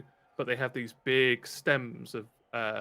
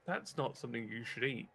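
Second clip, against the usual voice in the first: soft, worried NPC warning; concerned quiet; 11 dB quieter than usual. Acted. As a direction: gently warn them off.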